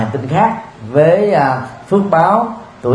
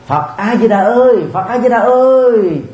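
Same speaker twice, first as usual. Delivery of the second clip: calling someone; 8 semitones higher than usual. Shown as a demonstration. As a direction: imitate hailing a person by name. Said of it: "Giống như mình kêu một người nào đó". (giả giọng gọi ới một người)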